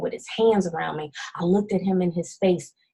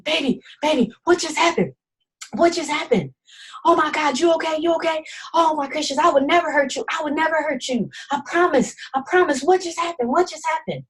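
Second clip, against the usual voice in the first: higher, frantic pleading man; panicked boyfriend; 8 semitones higher, faster than usual. Retold or imitated. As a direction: gush apologies in a rush of panic. Abuser voiced panicking after choking.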